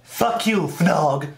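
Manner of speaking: normal talking voice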